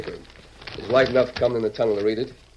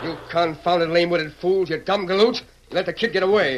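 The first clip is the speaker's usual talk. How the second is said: angry scolding; 6 semitones higher than usual. Acted. Villain berating his henchmen.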